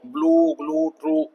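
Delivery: ब्लू, ग्लू, ट्रू each with a long oo; long oo sound. Shown as a demonstration. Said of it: "long ओ".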